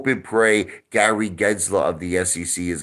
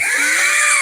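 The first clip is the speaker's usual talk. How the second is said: high mocking giggle; 3 dB louder than usual. Real, not acted. Mocking rival, bursts out laughing.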